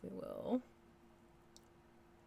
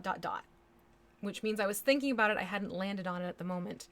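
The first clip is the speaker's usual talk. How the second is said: usual speaking voice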